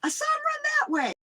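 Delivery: very high falsetto innocence; mock innocent. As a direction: play dumb in a squeaky voice. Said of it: "I'd say".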